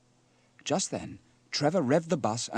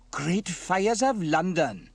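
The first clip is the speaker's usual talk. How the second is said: loud, slow, raised-pitch amazement; astonished exclamation; 8 semitones higher, 7 dB louder, much slower than usual. Acted. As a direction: exclaim with each word stretched. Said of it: "exclaimed Sam".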